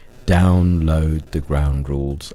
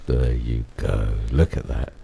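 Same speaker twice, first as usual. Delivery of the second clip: deep voice filter; 8 semitones lower than usual. Real, not acted. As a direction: voice dropped by a knob twist. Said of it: "With the twiddle of a knob".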